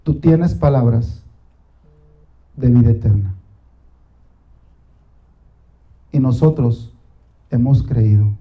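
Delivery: low, hushed, unhurried prayer; oración íntima. Acted. Opening prayer, low and slow. (oración grave, lenta y recogida)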